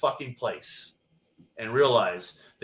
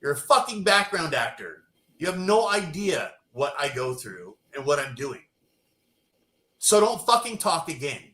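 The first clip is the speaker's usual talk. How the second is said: angry scolding; 4 semitones higher than usual. Retold or imitated. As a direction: put him in his place. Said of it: "And she goes".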